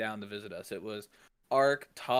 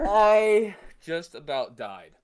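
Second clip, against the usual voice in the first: slow, loud exasperated laugh-line; nearly dying of laughter; 5 semitones higher, 11 dB louder, much slower than usual. Real, not acted. Friend says he nearly died, loud and slow. Reacting to story.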